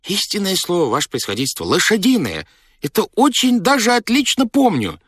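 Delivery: high-pitched, earnest servant; insistent steward. A narrator's reading. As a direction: swear it to the master in a high voice.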